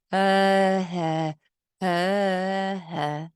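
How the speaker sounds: "Uh, huh" as a flat neutral vowel; centralized vowels. Shown as a demonstration. Therapist mimicking a centralized child sound. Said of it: "So it's centralized".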